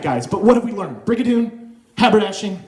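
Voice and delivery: usual speaking voice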